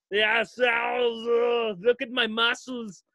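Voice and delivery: hammed-up Austrian muscleman with drawn-out groans; Arnold Schwarzenegger. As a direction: parody a show-off action hero. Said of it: "you see him and he's always like".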